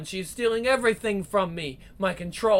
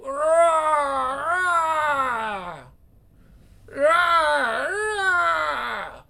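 fierce rising war cries, far higher pitch; battle cries; 8 semitones higher, much slower than usual. Acted. Queen slamming foes in battle, fierce high war cries. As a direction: yell out each strike as you fight.

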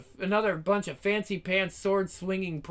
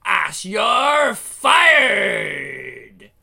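very slow, loud demonic bellow; monstrous shout; 9 dB louder, much slower than usual. Acted. Demon boss firing Ash, a slow booming bellow. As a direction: stretch it into a demon roar.

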